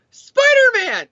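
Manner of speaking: high, chirpy eager reply; squeaky high-pitched Spider-Man!; eager Dan answer. Acted. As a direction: pipe up like a kid begging for his favourite toy. Dan Slott voiced answering editor.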